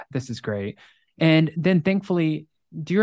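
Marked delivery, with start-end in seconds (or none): 0.1-0.8 sarcastic mutter. Retold or imitated. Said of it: "So I'm like".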